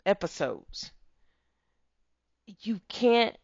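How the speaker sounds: ordinary delivery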